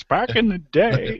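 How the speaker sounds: put-on high, slow old man voice. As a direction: croak it like an old geezer. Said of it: "like when Dave Jackson says".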